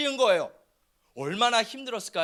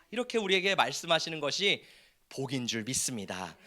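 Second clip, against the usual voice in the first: ordinary talk, nothing marked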